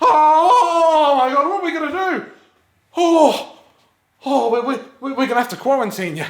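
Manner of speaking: high, loud frantic cries; panicked wailing. Acted. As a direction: wail in a panic about the quarantine. Panicking about quarantine.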